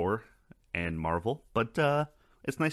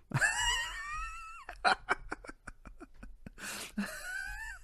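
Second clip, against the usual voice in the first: high squealing laugh; 6 dB quieter than usual. Real, not acted. Laughs at the accent joke.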